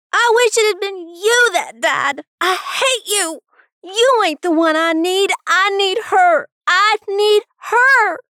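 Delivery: anguished high screaming, slowing at the end; screamed. A narrator's reading. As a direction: scream out your grief and rage at your father. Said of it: "he screamed".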